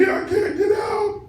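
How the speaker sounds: very high, slow, drawn-out mock scream; retold frantic shriek. Trapped daughter crying to her mother on the phone, retold frantic shriek. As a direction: wail it out in hammed-up terror.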